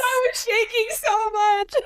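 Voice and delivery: high, slow words through laughter; laughing admission. Real, not acted. Telling friends about trembling at Saw Ten, laughing. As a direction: laugh while owning up to it.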